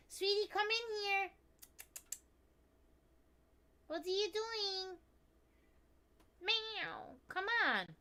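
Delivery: high, singsong pet voice. Real, not acted. Baby talk to her cat.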